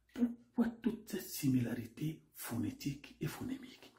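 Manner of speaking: hushed, secretive whispered question; mysterious whisper. Acted. Narrator whispering a mysterious question to the audience.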